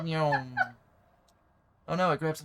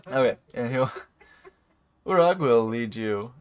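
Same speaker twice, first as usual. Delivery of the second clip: normal talking voice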